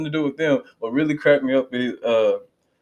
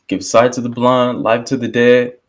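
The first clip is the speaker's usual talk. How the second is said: ordinary delivery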